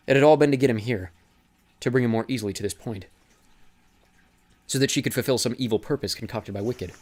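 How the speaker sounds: ordinary talk, nothing marked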